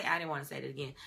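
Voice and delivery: ordinary talk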